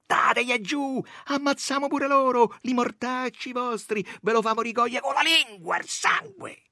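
high-pitched angry incitement; rally the mob to revenge, voice high; vengeful cries. A narrator's reading. Women cursing the policemen after bloodshed, high angry crying.

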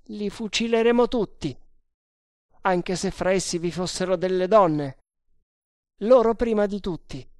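high, emphatic threatening voice; ruthless sergeant. Acted. Sergeant threatening to shoot every Tug, ruthless.

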